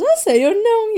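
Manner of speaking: friends' high mock reaction to her crying; mimicking friends. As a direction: play the friends, squeaky and smug. Friends' unimpressed reply, voiced.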